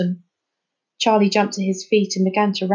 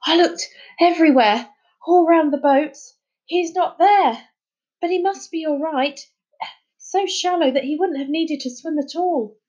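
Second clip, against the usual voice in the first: high, breathless report; 6 semitones higher than usual. Acted. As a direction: gasp it out after the swim. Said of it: "dripping and breathless".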